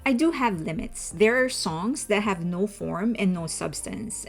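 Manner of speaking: normal talking voice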